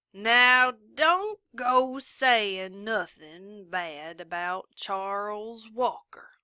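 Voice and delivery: a lightly voiced sheriff's warning; drawling sheriff. A narrator's reading. Sheriff warns her.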